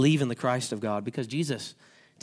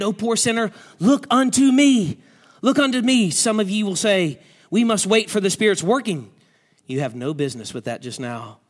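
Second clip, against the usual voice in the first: a hint of a broad country preacher, building higher and louder on look unto me; preacher in broad accent; 7 semitones higher, 7 dB louder than usual. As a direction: preach it plainly, then swell. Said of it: "he went on in a broad accent".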